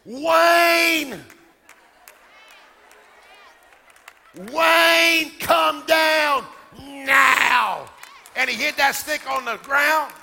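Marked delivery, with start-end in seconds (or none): 0.0-7.9 put-on child's shouted command, R said as W; little boy commanding rain. Five-year-old with a stick ordering rain down, imitated shout. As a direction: cry it out big and drawn-out, like a small boy. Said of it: "back then he talked like this".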